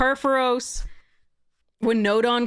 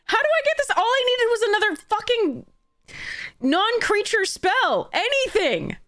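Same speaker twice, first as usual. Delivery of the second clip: much higher, frantic indignant outburst; exasperated rant; 10 semitones higher than usual. Real, not acted. Player ranting at opponent's perfect land draws, high-pitched outrage. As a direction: shriek it in disbelief at the bad luck.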